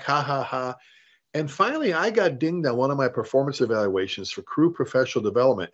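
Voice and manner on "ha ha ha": imitating a dismissive laugh, half-hearted; spoken-out ha-ha, not really laughing; mock laugh. Firefighter laughing off a failed test, mock laugh. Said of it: "and laugh".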